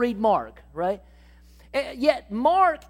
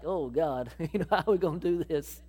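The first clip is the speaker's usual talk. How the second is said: low, quick, chuckling disbelief; doubtful laugh; 7 semitones lower, 4 dB quieter than usual. Acted. Andrew doubts they can feed everyone, laughing disbelief. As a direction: laugh it off as impossible. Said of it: "He's saying that like".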